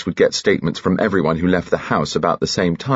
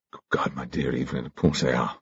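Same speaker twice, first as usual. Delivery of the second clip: low grumbling husband; 4 semitones lower, 8 dB quieter than usual. A narrator's reading. Husband voiced in story.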